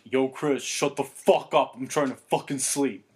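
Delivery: retold irritated outburst. Friend trying to sleep scolds noisy couple, irritated. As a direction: tell them off, hitting the swearwords hard.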